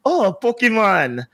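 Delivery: airport lady. Retold or imitated. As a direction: lightly imitate a lady cooing in recognition. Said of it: "there was even a lady at the airport".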